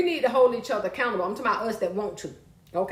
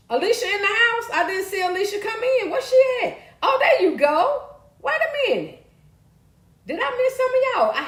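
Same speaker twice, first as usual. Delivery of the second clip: higher, louder welcome to a viewer; light up when a friend arrives; high bright call of hello to Alicia; excited greeting; 10 semitones higher, 4 dB louder than usual. Real, not acted.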